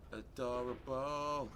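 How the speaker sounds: normal talking voice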